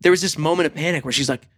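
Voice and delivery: normal talking voice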